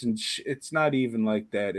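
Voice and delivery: normal talking voice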